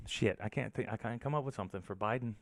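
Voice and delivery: ordinary delivery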